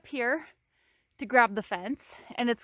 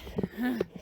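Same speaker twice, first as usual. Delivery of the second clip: frustrated sigh; 6 dB quieter than usual. Real, not acted.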